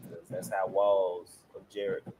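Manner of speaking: ordinary talk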